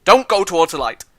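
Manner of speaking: mock-urgent shout; shouted warning. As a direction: call out to stop someone. Warns the dying character.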